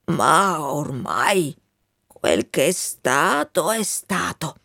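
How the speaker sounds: put-on slow, resigned old soldier; weary dying colonel. Colonel asks his friend to leave him, weary resignation. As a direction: let go with long, tired pauses.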